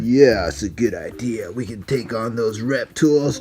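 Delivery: deep villain voice. Pretend. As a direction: plot in a very deep voice. Villains plotting attack.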